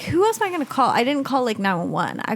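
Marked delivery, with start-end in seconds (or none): 0.0-0.9 much higher, a desperate retold question; incredulous squeak. She retells deciding to call the police, squeaking high. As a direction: lift it way up, half-mocking her past panic. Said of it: "'cause I was like".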